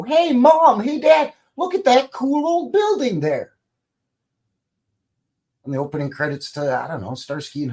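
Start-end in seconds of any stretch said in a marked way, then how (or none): 0.0-3.5 put-on high, loud child's voice; excited kid. Kid pointing at the TV for Mom and Dad, excited child voice. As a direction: call out to parents like an amazed kid. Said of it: "point at the T V and go".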